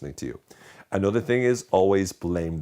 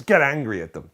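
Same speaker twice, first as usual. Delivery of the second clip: sudden louder, higher mock-angry burst; mock angry outburst; 7 semitones higher, 6 dB louder than usual. Shown as a demonstration. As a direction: snap suddenly into hammed-up anger.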